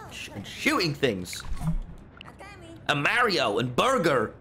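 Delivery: put-on over-the-top American accent; fake American accent. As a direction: play a cartoonish American host. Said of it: "if I do an American accent".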